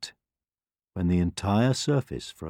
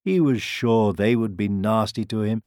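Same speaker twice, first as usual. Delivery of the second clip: ordinary delivery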